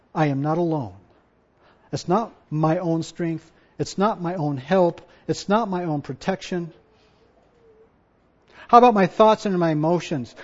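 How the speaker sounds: ordinary talk, nothing marked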